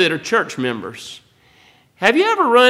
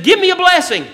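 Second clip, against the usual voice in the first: a bold plea, much higher and louder; bold request; 13 semitones higher, 5 dB louder, faster than usual. Retold or imitated. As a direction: ask boldly, like a child riding right up to her father. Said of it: "That's pretty bold".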